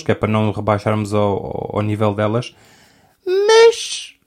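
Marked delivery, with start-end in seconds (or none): 3.3-4.1 a sudden falsetto mas; high squeaky but. Real, not acted. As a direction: leap way up on the but. Dramatic pivot.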